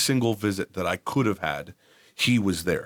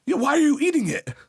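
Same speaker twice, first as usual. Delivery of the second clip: much higher, louder mock disbelief; high incredulous question; exasperated parent; 4 dB louder than usual. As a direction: ask it like you can't believe it. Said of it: "parent questions the toddler".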